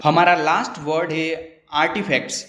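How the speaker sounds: normal talking voice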